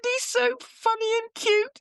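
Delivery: very high, slow, put-on gushing voice; mock cooing. Quoting herself cooing at the boss's son, very high. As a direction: coo over the naughty child. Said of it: "I said".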